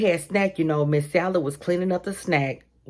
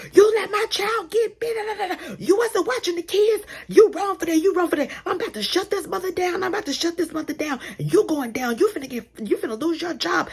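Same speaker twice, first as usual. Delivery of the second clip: angry mom yelling; 13 semitones higher than usual. Retold or imitated. Angry mom storming in, yelling at the teacher. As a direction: tear into her like an outraged parent. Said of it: "bust through the door and was like".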